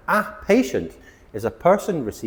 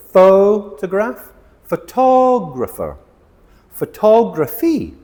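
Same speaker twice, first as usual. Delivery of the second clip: slow, loud, high drill of "photograph", "photographer", "photography"; teacher drilling shifting stress, loud and slow; stress contrast drill; 8 semitones higher, 7 dB louder, slower than usual. Shown as a demonstration. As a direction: lean hard on each stressed syllable, slowly. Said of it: "we stress the tog".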